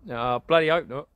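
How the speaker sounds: usual speaking voice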